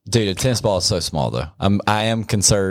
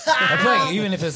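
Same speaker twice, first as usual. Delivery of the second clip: normal talking voice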